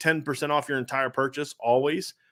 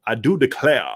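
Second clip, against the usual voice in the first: mock Southern accent; 5 dB louder than usual. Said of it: "That's what Brian Kelly sounded like".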